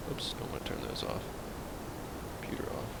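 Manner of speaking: very soft, barely voiced; nearly whispered aside; under-breath mutter. Real, not acted. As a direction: mutter to yourself. Muttering while fixing controls.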